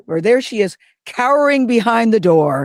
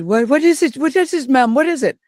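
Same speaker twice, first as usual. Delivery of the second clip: lightly voiced nanny, pitched up; higher-pitched quoted questions; retold worried nanny; 7 semitones higher than usual. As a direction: imitate a nanny's anxious questioning. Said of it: "And she said".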